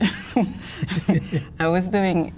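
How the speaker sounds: slow words broken by a real laugh; chuckling while starting the sentence. Real, not acted. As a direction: laugh through it, drawn out. Laughing at her own age joke.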